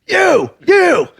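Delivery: high, falling calls; accusing yell. Retold or imitated. Driver spotting the man running away, high accusing shouts. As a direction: call out after someone getting away. Calling out at fleeing tire slasher.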